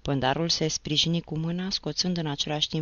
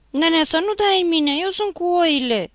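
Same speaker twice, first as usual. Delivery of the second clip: much higher, loud terrified plea; frightened cry; 13 semitones higher, 9 dB louder than usual. Acted. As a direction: beg him not to strike, panicked. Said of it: "strigă Nicolae speriat". (strigăt speriat, mult mai sus și tare)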